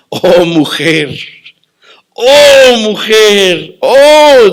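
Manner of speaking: high, loud wailing cry of compassion; Jesus calling out with loud, aching pity; anguished cry. Acted. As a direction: cry it out from the gut, voice breaking. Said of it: "Jesús no estaba actuando".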